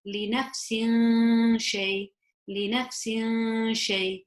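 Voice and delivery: slow, long nasal hum at the end of nafsin before shay; hidden ikhfa sound. Shown as a demonstration. Teacher showing ikhfa, nafsin held and hidden into shay. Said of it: "I would hide that kasratain sound into the sheen".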